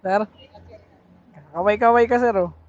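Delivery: usual speaking voice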